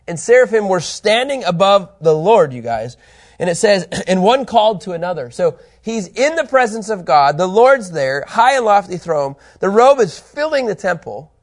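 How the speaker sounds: loud, high-pitched, emphatic preaching; impassioned preaching. Acted. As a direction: preach it with rising force, punching key words. Preaches with raised force.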